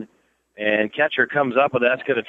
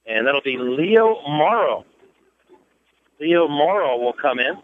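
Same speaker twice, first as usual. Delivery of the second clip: ordinary talk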